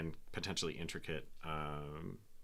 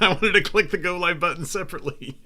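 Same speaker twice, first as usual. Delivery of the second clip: laughing; 12 semitones higher, 12 dB louder than usual. Real, not acted. Laughs at own mishap.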